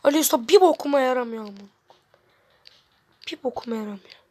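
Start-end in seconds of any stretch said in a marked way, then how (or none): 3.3-4.2 whisper. Real, not acted. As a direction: whisper Bir bokuma yaramıyor under the breath. Aynı sözü fısıldayarak tekrar. (fısıltıyla söyleniyor)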